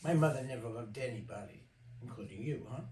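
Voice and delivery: sneering. Retold or imitated. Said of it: "he's curling his mouth like that".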